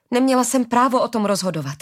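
distressed protest. Acted. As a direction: daughter cries out that it was not her right. Arila protests her own guilt.

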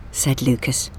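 ordinary delivery, nothing marked

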